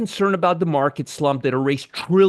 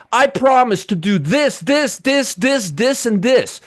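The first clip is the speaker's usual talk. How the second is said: higher, sing-song list of promises; mock promising; 5 semitones higher, slower than usual. Host mimics the president's promises to viewers, mocking. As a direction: reel off each mock promise with a bouncing emphasis.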